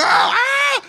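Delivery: sudden high terrified yelp; panicked scream. Real, not acted. Man freeing a trapped bird, sudden terrified shriek. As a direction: shriek as the bird flaps at you.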